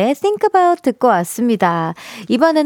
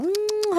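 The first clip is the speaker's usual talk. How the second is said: high, soft soothing hum; 10 semitones higher, 8 dB quieter, much slower than usual. Shown as a demonstration. Groomer calming nervous puppies, soothing high hum. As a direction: hum gently to settle a nervous dog. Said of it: "하면서 뭔가 달래주고 해야지만".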